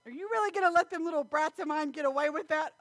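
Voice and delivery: loud, high-pitched incredulous protest; mock indignation. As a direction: protest in hammed-up outrage. Protesting dad's leniency with grandkids.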